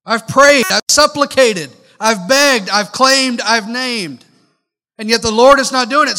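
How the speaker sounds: loud, exasperated list of prayers; venting at an unanswering God; frustrated pleading. Retold or imitated. Preacher voicing a frustrated believer, loud and exasperated.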